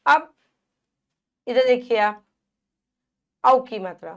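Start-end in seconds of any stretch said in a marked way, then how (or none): none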